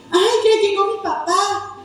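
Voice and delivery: put-on cheerful child greeting dad; happy welcome. Child glad dad is home, cheerful welcome. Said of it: "en vez de decir".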